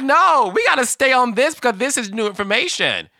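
high, fast, urgent plea to stay on the topic; exasperated protest. Retold or imitated. Fan pleading with the show to keep this conversation going, high and urgent. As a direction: insist they can't move on now.

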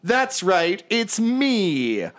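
high, self-satisfied show-off voice; preening bard. Acted. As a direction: announce yourself like a star. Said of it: "he stops and he goes".

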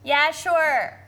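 drawn-out Minnesotan "Yeah, sure"; hammed-up Minnesotan accent. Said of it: "worst Minnesotan accents".

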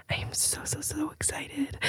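breathy whisper, slow at the end; excited whisper. Real, not acted.

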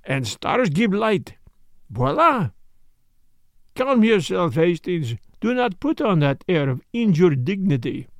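put-on French-accented detective, amused and commanding; Poirot, teasing then brisk. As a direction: soothe and chivvy your offended friend, French accent.

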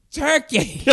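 very high mock drunk-girl cry; hammed-up high holler; drunk heckler. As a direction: imitate a drunk heckler calling out. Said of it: "she's like".

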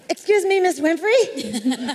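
high, loud call, then drawn slowly. Retold or imitated. Calling up to Oprah on the stairs, high loud nervous call. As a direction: shout up the stairs, then stretch her name.

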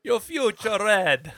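slow, high Jamaican psychic voice; Miss Cleo impression. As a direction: read a fortune slowly, loud and high. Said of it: "Miss Cleo".

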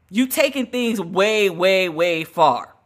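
each "way" stretched slowly, building to "far"; drawn-out way, way, way far. Real, not acted. Host mocking the royals with a slowly stretched "way, way, way far". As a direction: lean on "way, way, way far" to mock how far it went.

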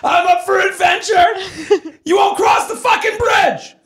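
high-pitched mocking yell; shrieking, hammed-up indignation; mocking outrage. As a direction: shout it in exasperated mockery. Mocking her words with outrage.

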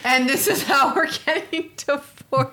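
high, loud hammed-up alarm; mock panicked wife. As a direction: play the alarmed spouse for laughs.

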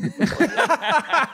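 a retold outburst, hammed up high and slow; mock blowup. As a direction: blast him out of the room. Retold dismissal.

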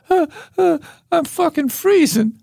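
shiver out the punchline like a freezing cub; shivering baby bear. Retold or imitated. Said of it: "the baby polar bear says".

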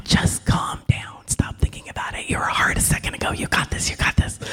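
hushed coaching whisper; calm him down without anyone hearing; whispered pep talk in his ear; soothing whisper. Retold or imitated. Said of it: "I'm like".

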